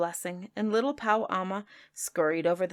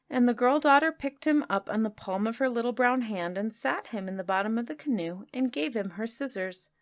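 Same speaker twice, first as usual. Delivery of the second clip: plain reading voice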